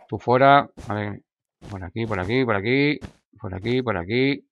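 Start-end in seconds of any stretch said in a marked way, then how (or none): none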